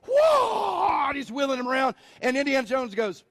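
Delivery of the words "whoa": high hammed-up battle cry; mock swordsman's shout. Swordsman jumping out at Indiana Jones, hammed-up shout. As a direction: yell it like the sword-waving thug. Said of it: "and he's like".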